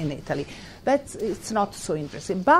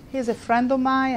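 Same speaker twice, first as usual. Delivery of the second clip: normal talking voice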